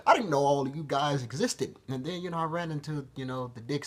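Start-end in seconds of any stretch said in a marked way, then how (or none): 0.1-1.8 a high, loud shout of wonder; amazed shout. Retold or imitated. Vlogger meeting the voices in his head, shouting in amazement. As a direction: yell out in sheer amazement. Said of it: "And I was like".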